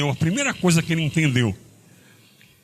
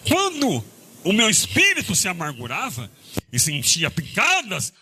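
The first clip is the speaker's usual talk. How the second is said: raised Bible reading voice; measured psalm reading; scripture reading; 4 semitones higher than usual. Acted. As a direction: read the verse solemnly. Reads psalm verse aloud.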